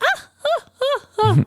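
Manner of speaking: high excited ahs; high ah-ah recognition. Retold or imitated. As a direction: yelp it in recognition. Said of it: "I was like".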